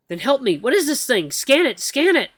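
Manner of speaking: high, loud frantic commands; ordering the AI to scan the beast; panicked orders. Acted. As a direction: bark at the AI in panic. Urging AI in panic.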